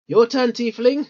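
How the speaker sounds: Torok waking Tullius. Acted. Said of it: "Torok pokes Tullius".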